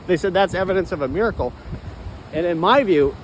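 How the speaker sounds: normal talking voice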